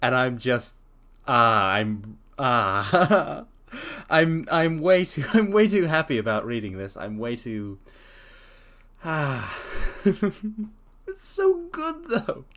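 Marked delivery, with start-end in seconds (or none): none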